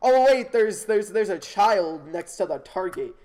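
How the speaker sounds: ordinary talk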